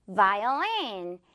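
"Violin" drawn out with buzzing v; clear V demo. Shown as a demonstration. Teacher introducing the second word, clear V. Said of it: "요거 V 발음 살려서".

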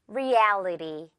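Reality slowly, the "al" long and open; stretched middle syllable. Shown as a demonstration. Teacher modelling after correcting, Reality with a long "al". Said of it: "L이라는 거".

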